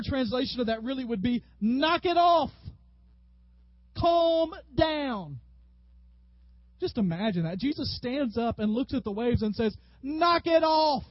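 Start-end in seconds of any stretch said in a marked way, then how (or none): 1.6-5.4 high, loud commanding yell; yelled rebuke. Acted. Preacher retranslating Jesus' rebuke of the waves, loud yell. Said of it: "ever yelled that at your children". 10.0-11.0 loud, high, drawn-out shout; yelled order. Acted. Jesus standing up to the waves, yelled rebuke. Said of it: "How many of you have ever yelled that".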